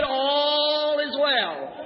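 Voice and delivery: one long, stretched ALL; preacher's sustained cry; drawn-out declaration of faith. Acted. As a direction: hold the words out very slowly. Preacher voices the woman's faith cry.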